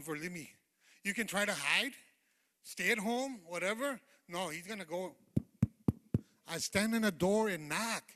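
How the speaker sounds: loud preaching with stretched key words; impassioned preaching. Real, not acted. Preacher telling congregation nobody outruns God, impassioned preaching. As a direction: preach it out to the whole room.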